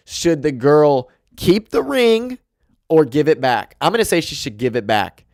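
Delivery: usual speaking voice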